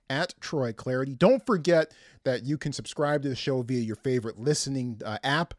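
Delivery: normal talking voice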